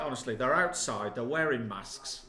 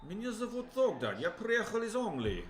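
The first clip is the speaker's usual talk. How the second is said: high, laughing put-on Russian; mock Russian speech; 7 semitones higher than usual. Answering the challenge, laughing mock Russian, higher. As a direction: ham it up in Russian, higher pitch. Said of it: "He's done it".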